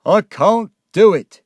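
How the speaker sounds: loud, high outburst of despair; distraught and shouting; anguished cry. Acted. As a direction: cry out as if at breaking point. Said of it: "he cried".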